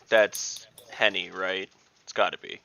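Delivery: ordinary talk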